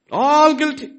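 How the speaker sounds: all guilty shouted high and loud; emphatic shout. Real, not acted. Preacher driving home guilt, loud emphatic cry. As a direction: hit "All" hard and loud.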